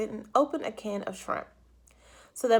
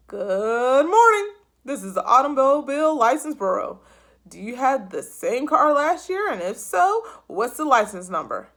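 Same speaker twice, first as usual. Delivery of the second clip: a formal clerk on the line; official phone voice; 3 semitones higher, 8 dB louder than usual. A narrator's reading. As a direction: license bureau clerk answering the phone. New bureau voice.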